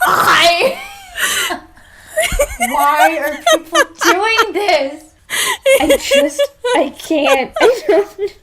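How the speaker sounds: high, loud, slow laughing disbelief; exasperated laugh-cry. Real, not acted. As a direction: wail through laughter at the absurdity. Appalled by fanfic update time.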